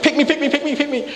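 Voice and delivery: high squeaky pick-me plea; eager kid in class. Retold or imitated. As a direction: beg to be called on, way up high. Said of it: "They raise their hand like".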